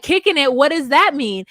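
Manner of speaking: indignant challenge. Retold or imitated. Acted quarrel, woman challenges man.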